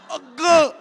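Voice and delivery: loud, high mock brush-off; dismissive shout. Barbara waving her off, mock loud dismissal. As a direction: wave someone off loudly.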